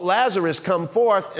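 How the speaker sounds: loud, high commanding call. Shown as a demonstration. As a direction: call him out of the tomb with authority. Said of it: "he said it in a loud voice, a commanding voice".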